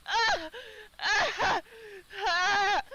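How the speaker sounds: high loud ah ah ah; hammed-up pained cries. Dottie crying out from scalding soup. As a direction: yelp from a burnt tongue. Dottie gasping at burnt mouth.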